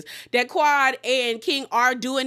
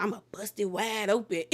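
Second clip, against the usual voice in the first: softer mock Quad voice; a quieter, teasing imitation of Quad; mimicking Quad; 3 semitones lower, 7 dB quieter than usual. Cast members mimicking Quad, softer mocking voice. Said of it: "like, mimicking Quad".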